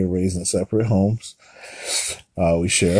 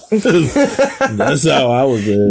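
said through a slow, higher laugh; 6 semitones higher, 4 dB louder, much slower than usual. Real, not acted. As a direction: laugh the words out slowly. Laughing reply to partner.

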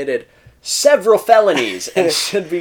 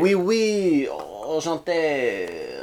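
mock French; 4 dB quieter, much slower than usual. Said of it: "I'm allowed to speak German".

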